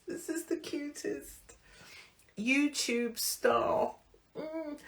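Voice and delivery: high, soft voice breaking into tears; fighting back tears; choking up. Real, not acted. Reading a fan's letter, starting to cry. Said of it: "gonna set me off".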